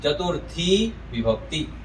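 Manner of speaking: चतुर्थी with a long, lifted final "thee"; demonstrated pronunciation. Shown as a demonstration. Teacher shows students the case name, चतुर्थी with a held ending. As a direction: stretch the last vowel of चतुर्थी, then विभक्ति. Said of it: "ऐसे उच्चारण करते हैं".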